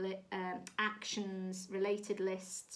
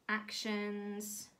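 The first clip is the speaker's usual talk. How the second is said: ordinary delivery, nothing marked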